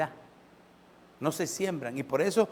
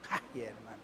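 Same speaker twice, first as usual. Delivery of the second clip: moved sigh; 9 semitones lower, 14 dB quieter than usual. Real, not acted. Moved aside after the verse.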